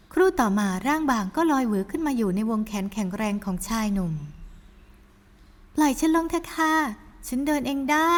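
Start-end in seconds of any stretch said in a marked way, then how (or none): 4.2-8.1 put-on higher, slow girlish voice; sweet daughter. Daughter reassures her mother, higher and slow. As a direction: coax mother gently, high.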